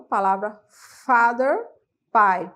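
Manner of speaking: usual speaking voice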